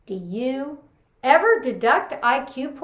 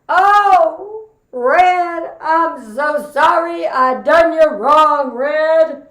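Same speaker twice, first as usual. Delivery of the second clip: mock wailing grief; 5 semitones higher, 8 dB louder, much slower than usual. Vernon wailing over the corpse.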